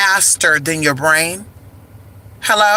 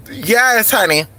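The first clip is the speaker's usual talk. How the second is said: normal talking voice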